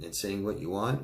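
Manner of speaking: usual speaking voice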